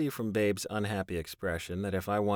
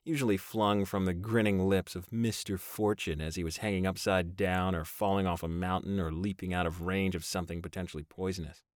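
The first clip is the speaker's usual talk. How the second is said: ordinary delivery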